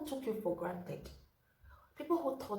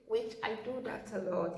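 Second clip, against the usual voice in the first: ordinary delivery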